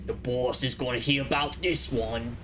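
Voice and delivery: lightly put-on mob goon voice; mafia voice. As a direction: talk like a wiseguy henchman. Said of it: "all the N P Cs talk like this".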